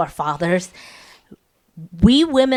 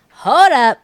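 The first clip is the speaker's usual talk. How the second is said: louder, higher mock retort; indignant rebuff; 6 semitones higher, 10 dB louder than usual. Wife stopping her husband's complaint, indignant. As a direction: cut him off sharply.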